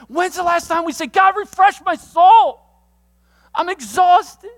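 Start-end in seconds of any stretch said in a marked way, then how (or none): 1.1-4.5 slow, loud, high cry to God; exhausted cry. Acted. Preacher crying out to God for his tired soul, loud and slow. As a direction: shout the plea like you're worn out.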